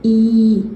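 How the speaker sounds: teacher stretching ई; long ई demo. Shown as a demonstration. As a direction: sound out a long ई firmly. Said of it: "अलिफ या ज़ेर".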